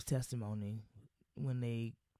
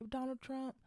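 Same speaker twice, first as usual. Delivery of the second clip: higher, snooty mock-celebrity voice; lampooning a smug TV guest, higher; 8 semitones higher than usual. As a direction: play her as smug and oblivious. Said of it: "she's like".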